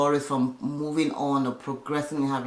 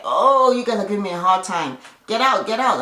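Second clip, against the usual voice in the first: higher, louder mock complaint; exasperated; 6 semitones higher, 6 dB louder than usual. Retelling a performer chasing watching relatives from rehearsal, exasperated. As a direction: playfully imitate someone shooing people out.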